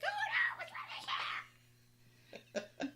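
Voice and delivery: very high, loud pretend-terrified scream; mock screaming. Sister reenacting prank on younger girls, screamed. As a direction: shriek it like kids faking horror. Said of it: "We were screaming 'cause we wanted it".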